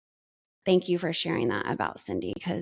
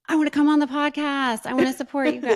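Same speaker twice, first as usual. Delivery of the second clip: higher, louder, eager imitation of Ethan; eager quote; 9 semitones higher, 5 dB louder, faster than usual. Friend imitating Ethan asking to join the podcast, eager and raised. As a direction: bounce in with it like an excited kid volunteering. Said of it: "He's like".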